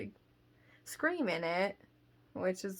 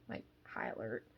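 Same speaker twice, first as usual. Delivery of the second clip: ordinary talk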